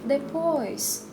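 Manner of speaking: ordinary talk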